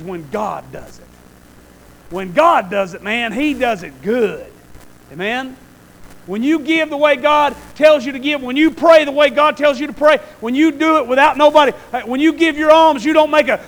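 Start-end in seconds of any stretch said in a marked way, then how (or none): 6.3-13.6 loud, high preaching build. Real, not acted. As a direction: rise into full preaching. Rising preaching.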